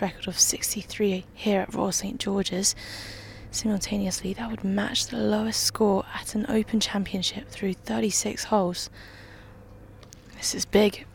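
hushed, whispered golf commentary; golf commentator. Real, not acted. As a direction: whisper it like a tense TV golf call.

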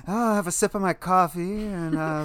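lightly voiced man mumbling his own narration; a hint of a man narrating to himself; mumbling Pierce. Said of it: "so much of him mumbling to himself".

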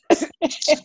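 bright high giggle; high laugh. Real, not acted. Laughing at auntie joke.

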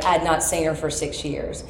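ordinary delivery

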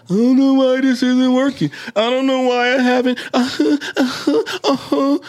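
high, loud mock-clueless moaning; acting dumb. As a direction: wail it like a hammed-up helpless complainer. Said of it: "a lot of times we act dumb".

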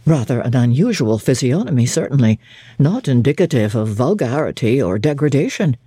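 put-on stern young clergyman judging a stranger, curt and low; terse clergyman. A narrator's reading. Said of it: "in the tone of a man little accustomed to expansive comment".

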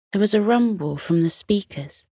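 plain reading voice

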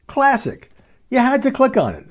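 high, emphatic jab at clickbait; mock outrage. Real, not acted. Scoffing at clickbait for podcast listeners, mock outrage. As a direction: sneer at the clickbait, pitch way up.

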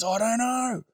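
high, exasperated mock anger; angry boy. Boy at a loss before sitting down, mock angry. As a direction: imitate a worked-up lad at his highest pitch. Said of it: "he was all angry".

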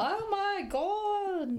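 high, drawn-out British exclamation; hammed-up British astonishment; mock British accent. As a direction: stretch it slowly in a high comic British voice. Joining the mock British voices.

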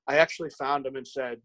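ordinary delivery

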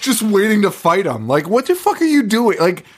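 high-pitched disbelief; real incredulous squeal at something silly; incredulous mockery. Real, not acted. Mocking a silly story premise.

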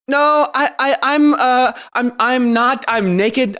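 high, loud, panicky cover-up; flustered. Acted. Flustered daughter hides from doctor.

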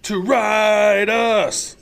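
very slow, loud drawn-out shout. Pretend. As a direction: belt it out like a rally cry. Co-host shouts requested line.

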